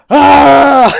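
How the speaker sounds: loud, high open-throated scream; mock scream. Podcaster imitating a face popping up, loud scream.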